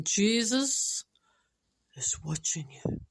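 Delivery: slow, lightly spooky voice; mysterious voice. Acted. Unseen voice warning a burglar in the dark, mysterious. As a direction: draw it out, pausing after the name. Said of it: "when he hears a voice".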